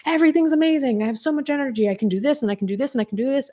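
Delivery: high, breathless-paced list of mock enthusiasm; manic excitement. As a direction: imitate someone giddy with too much energy. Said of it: "coulda maybe been a manic state".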